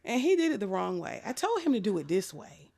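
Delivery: normal talking voice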